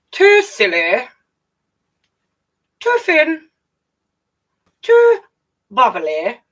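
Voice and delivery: judges' rising verdicts. Acted. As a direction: climb up and up to a loud squeal.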